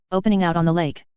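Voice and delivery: ordinary delivery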